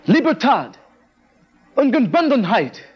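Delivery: high, fervent cry of freedom; ringing cry. A narrator's reading. A crowd crying out for liberty, high and fervent. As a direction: lift it up as a rallying call. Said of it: "cries of liberty".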